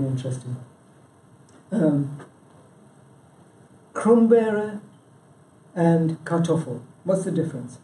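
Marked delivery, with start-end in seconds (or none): 3.9-4.8 Krummbeere said KROOM-bair-eh, higher pitch; German word. Shown as a demonstration. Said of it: "is potato in southern German dialect".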